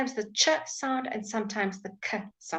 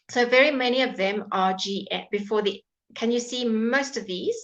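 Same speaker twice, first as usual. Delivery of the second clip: normal talking voice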